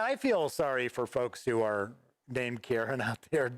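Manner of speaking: ordinary delivery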